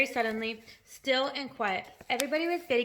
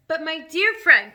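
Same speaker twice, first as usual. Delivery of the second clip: loud, higher, drawn-out appeal; forced cheer; 6 semitones higher, 12 dB louder, slower than usual. A narrator's reading. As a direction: try hard to cheer up a friend. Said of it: "trying to be cheerful".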